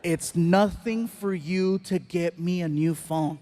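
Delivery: each word slow and spaced out; slow incredulous repeat. Retold or imitated. Dad stunned by son's remark, repeating it slowly. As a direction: echo it slowly, letting every word land.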